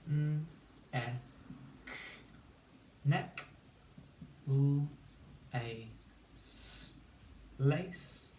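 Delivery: isolated sounds of "neck" and "lace", long pauses between; sounding out syllables. Shown as a demonstration. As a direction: blend each syllable after its separate sounds. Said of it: "say the sounds to build the word, syllable by syllable".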